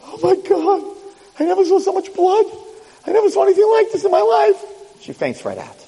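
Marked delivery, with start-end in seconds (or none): 0.1-4.6 high-pitched hysterical shock; horrified bystander. Acted. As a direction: play a woman horrified at the sight. Panicked bystander runs over.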